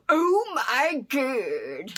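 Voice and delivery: drawn-out, very slow comic "oh my God"; Chris Farley. Retold or imitated. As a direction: stretch it out like a stunned comedian. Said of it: "Like, uh, Chris Farley does".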